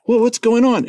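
startled wife. Retold or imitated. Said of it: "She's like".

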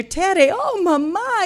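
put-on whining three-year-old calling his mother; screaming kid. Screaming child runs to mother.

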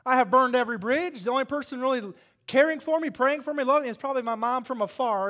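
testimony to the church, preached loud; preaching testimony. Acted. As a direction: preach it to the congregation, rising. Preaching his testimony at full force.

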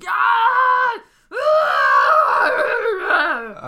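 high, loud wordless shrieks; wordless yells. Acted. Player struggling against soldiers, loud high wordless yells. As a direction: yell raw syllables like you're being dragged. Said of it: "And I just keep yelling. Just syllables".

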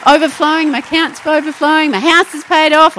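high, loud, jubilant claims; excited believer. Acted. Believer announcing big dreams to the church, exultant and loud. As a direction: gush like faith just hit you.

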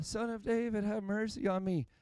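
soft, mild cry for mercy; understated plea. Shown as a demonstration. Preacher showing how the blind man did not cry out, soft and mild. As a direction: say the plea gently, too politely, with little urgency. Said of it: "I don't think it was just a".

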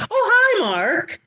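high squeal on oh hi; swooping movie line quote; Oh hi Mark impression. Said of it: "And they say it together".

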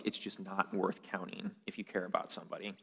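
soft whispered aside; whispered, tender point. Real, not acted. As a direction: breathe it quietly to them.

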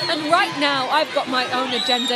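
loud, rousing crowd bellow. Acted. Leader rallying the rioting women, roaring defiance. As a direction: roar it out like a protest leader whipping up the mob. Riot chant, protesters bellowing demands.

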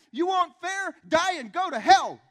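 high, loud furious retort; father yelling back. Retold or imitated. Dad yelling back at his six-year-old, furious. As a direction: imitate an enraged father's full yell. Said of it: "looked at him and yelled back".

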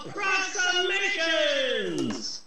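high, slow announcer call; show title announcement. Acted. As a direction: sell the show name grandly.

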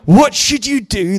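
high, loud, slow incredulous question; gasped astonishment. A narrator's reading. Sisters answering the helpless minister, gasping in disbelief. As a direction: throw the question back, aghast. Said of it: "they gasped".

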